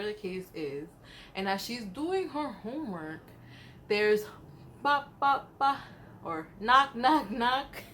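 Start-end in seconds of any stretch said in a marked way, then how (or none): 4.8-7.8 mimicked door knocks, bop bop; tapping out the knocks with her voice; knocking sounds.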